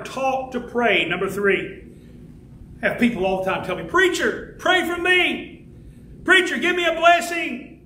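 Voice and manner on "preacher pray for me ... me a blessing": higher-pitched mock pleading; imitated pleading congregants. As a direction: imitate people pestering their preacher for favours. Imitates congregants begging the preacher.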